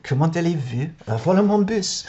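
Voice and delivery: mock French accent, speaking French; imagined Frenchman. Vlogger guessing how a Frenchman talks, mock French accent. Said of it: "that's how they talk".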